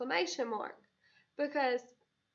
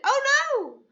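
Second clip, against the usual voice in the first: loud, high exclaimed "oh no"; excited; 12 semitones higher, 13 dB louder than usual. Shown as a demonstration. Teacher demonstrating the exclamation again, excited. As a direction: cry it out with excitement. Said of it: "I get excited".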